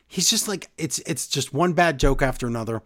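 usual speaking voice

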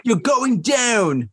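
hammed-up courtroom threat; mock threat. Joking lawyer threat to a rival, shouted slowly.

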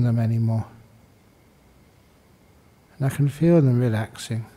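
calm meditation guidance with long pauses; guided meditation. Acted. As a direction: guide them unhurriedly into stillness, pausing between phrases.

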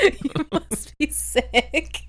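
giggling through the words, slowly; laughing. Retold or imitated. Repeats while laughing, slow.

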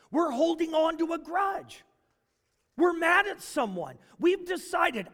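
high, loud preacher's cry; fervent preaching. Acted. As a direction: preach it out to the whole church. Preaching climax, voice raised.

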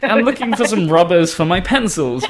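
high voice imitating a puzzled customer; acted innocent customer. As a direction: mimic her innocently asking, then baffled. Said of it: "she got a weird look".

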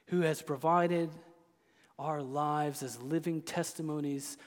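tender, quiet reassurance from the pulpit; hushed preaching. Acted. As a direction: draw them in with a near-hush. Soft, intimate sermon passage.

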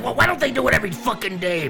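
high-pitched exasperated tirade; acted outrage, pitch way up; road-rage rant. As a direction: rail at the other commuters. Acted rant about traffic jam.

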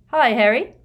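loud, high cheerful greeting; beaming hi. A narrator's reading. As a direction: call out happily.

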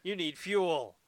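loud, hammed-up indignant rant; over-the-top huffy tirade; mock outrage. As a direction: rail at the driver like an offended aristocrat. Acted indignant rant at taxi driver.

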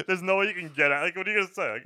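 usual speaking voice

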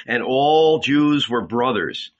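usual speaking voice

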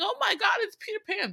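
high-pitched imitation of excited boys; mock starstruck excitement. Boys waking up to see Peter Pan, mock starstruck excitement. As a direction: squeal it like an excited kid, lightly acted.

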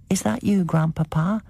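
put-on very high small child voice. A narrator's reading. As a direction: ask like a sleepy small child.

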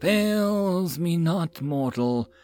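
very slow, menacing serpent voice; a threatening snake fading away; hissing serpent. Acted.

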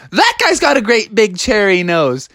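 put-on high-pitched child calling out; jeering kid. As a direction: point him out in a high kid voice. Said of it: "another kid was like".

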